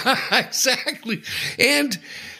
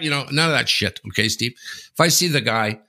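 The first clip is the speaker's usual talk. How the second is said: usual speaking voice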